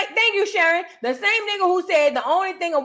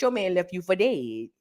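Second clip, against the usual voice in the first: ordinary talk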